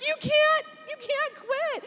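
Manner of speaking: high, retold plea starting loud; tearful pleading. Wife treading water begging husband not to quit, tearful. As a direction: imitate a desperate wife begging. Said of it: "I'm crying as I'm trading water".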